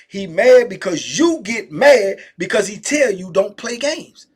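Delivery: usual speaking voice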